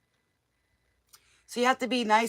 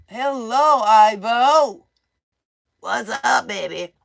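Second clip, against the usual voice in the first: playful call-out; 2 semitones higher, 10 dB louder, slower than usual. Real, not acted. Calling out to husband playfully.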